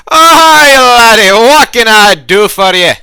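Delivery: very loud, high-pitched bartender hail; over-the-top shouting innkeeper with a put-on accent. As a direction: bellow a hearty welcome across the bar. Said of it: "you walk up to the bar and says".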